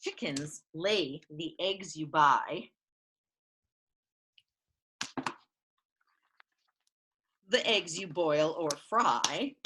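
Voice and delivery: slow storybook reading; reading to children. Acted. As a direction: read it slowly to little kids. Reads picture book aloud.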